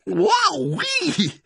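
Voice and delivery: very high, stretched squeal; amazed gasp. A narrator's reading. Smallest fox reels from fiery cider, gasping squeal. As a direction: squeal it out, overcome. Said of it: "he gasped".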